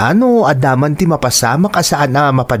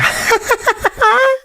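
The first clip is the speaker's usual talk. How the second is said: laugh. Acted. Friend laughs at teasing.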